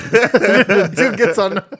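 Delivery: ordinary delivery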